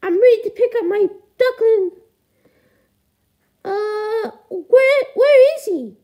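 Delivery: very high falsetto parent puppet voice; squeaky high voice, eager to collect a child; duckling's parent, expectant. Acted.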